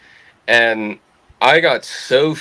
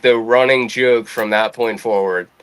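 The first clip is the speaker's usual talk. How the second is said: usual speaking voice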